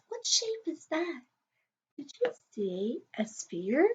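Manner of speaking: hushed secret whisper; playful storytime whisper. Acted. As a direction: lean in and whisper to the kids. Teacher whispers question to class.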